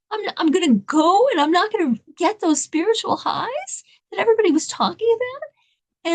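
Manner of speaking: high-pitched, acted dismay; imitating a torn seeker's plaintive objection; indignant protest. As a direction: argue with yourself, pitch well up.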